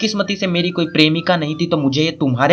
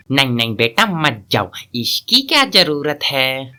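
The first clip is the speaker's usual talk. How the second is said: put-on lowered, drawn-out elderly objection; grandfather's voice; 2 semitones lower than usual. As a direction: play a grandad slowly talking the boy out of it. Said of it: "तभी दादाजी की आवाज आएगी".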